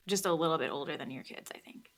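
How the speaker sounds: hushed, unvoiced aside; a teasing whispered confession. Real, not acted. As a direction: drop to a whisper for the joke. Whispered aside about age.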